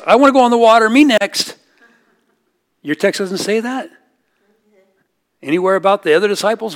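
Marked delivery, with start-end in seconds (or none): 0.1-1.6 high, loud mock-eager me next; eager clamouring. Disciples lined up at the boat clamour loudly to go next. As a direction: pester like kids wanting their turn.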